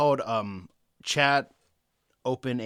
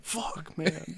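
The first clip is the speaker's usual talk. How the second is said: soft, half-laughed muttered curse; mock dejection; 4 semitones higher, 10 dB quieter than usual. Friend acting out a disappointing measurement, mock dejected. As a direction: let it out softly, playing deflated.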